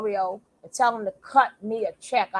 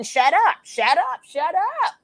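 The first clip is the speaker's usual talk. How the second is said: quoting TJ; 6 semitones higher, 6 dB louder, slower than usual. Retold or imitated. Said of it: "You heard T J keep saying".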